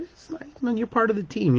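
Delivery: high, soft earnest reassurance; reassuring. Retold or imitated. Patient reassuring the cleaning lady she matters, high and soft. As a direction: lift it up gently to reassure her.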